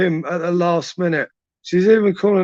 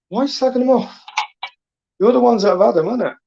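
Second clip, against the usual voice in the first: indignant challenge; 6 semitones higher, faster than usual. Real, not acted. Challenges men slagging women off.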